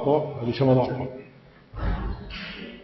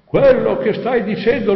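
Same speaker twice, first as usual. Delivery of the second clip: higher, insistent cry; raised voice, higher pitch; imitated shout; 7 semitones higher, 4 dB louder than usual. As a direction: call it out, mimicking the voice that shouted. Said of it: "È questo che grida".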